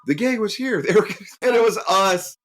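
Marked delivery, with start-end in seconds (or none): 0.0-0.8 put-on high, breathless kid reporting a scare; kid on the phone. Frightened kid calling the boys about the gang. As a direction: play the scared kid on the line. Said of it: "And it's, it was like".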